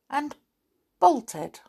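ordinary delivery, nothing marked

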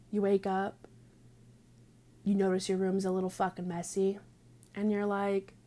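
normal talking voice

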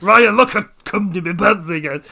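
loud, high, silly mimic; loud mocking voice. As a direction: ham up the friend's voice. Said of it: "mock quote of Stu".

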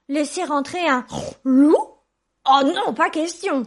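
high, loud piglet voice; indignant little pig. A narrator's reading. Second little pig to the wolf, indignant. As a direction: squeal a scandalized refusal as a piglet.

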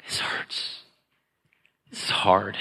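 very soft, breath-heavy sigh of hurt. Acted. Believer sighing to God, hushed and hurting. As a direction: let the hurt out under your breath. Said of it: "but the sighs".

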